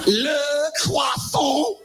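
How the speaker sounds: Le croissant drawn out slowly in a hammed French accent; mock French. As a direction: sneer it the French way, slow and high.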